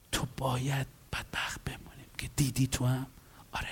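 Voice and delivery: low, very soft imitated exchange; hushed commiseration. Counselor commiserating with a self-pitying client, hushed. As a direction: murmur it like a shared sad secret.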